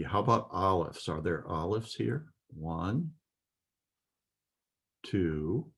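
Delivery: usual speaking voice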